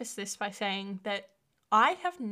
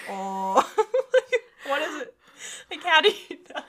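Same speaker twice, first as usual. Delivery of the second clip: laughing; 6 semitones higher, 7 dB louder than usual. Real, not acted. Laughing at own dark web question.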